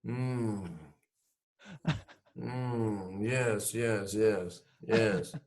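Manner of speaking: soft, grave mock-solemn yeses; trying not to smile. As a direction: play it straight, holding back a laugh. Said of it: "Let me try and keep a straight face".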